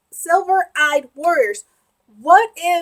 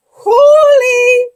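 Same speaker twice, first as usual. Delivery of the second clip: exclaiming; 8 semitones higher, 10 dB louder than usual. Real, not acted. Exclaiming in surprise.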